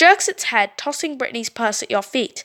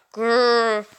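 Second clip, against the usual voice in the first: growl. Acted. Creature growls.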